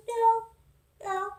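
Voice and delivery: put-on kid voice. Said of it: "He's like".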